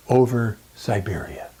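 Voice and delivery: ordinary talk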